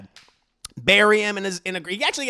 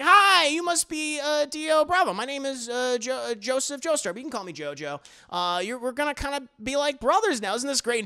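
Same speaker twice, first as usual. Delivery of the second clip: higher, cheerful eager greeting; eager nice boy; 7 semitones higher than usual. Retold or imitated. JoJo welcoming Dio, higher eager voice. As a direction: greet him like an overeager puppy.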